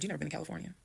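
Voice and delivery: soft, flat statement of fact; just state it softly, unbothered; very calm. Retold or imitated. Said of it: "I'm very calm".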